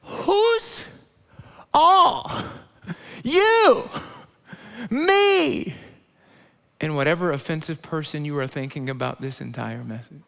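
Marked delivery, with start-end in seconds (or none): 0.1-5.7 high, loud call to the crowd. Real, not acted. Preacher calling out to the congregation, high and loud. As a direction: fling each word out to the room.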